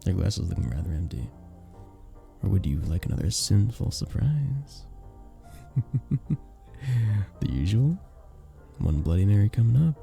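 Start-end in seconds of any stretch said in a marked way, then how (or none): 5.8-6.4 short teasing laugh; light, amused little laugh; flirty chuckle. Acted. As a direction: chuckle playfully after your offer. Flirty laugh after teasing offer.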